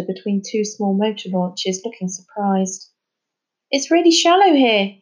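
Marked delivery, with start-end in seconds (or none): 3.7-5.0 high, loud call up from the water. Acted. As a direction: call up to friends on the wall. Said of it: "he called up to them".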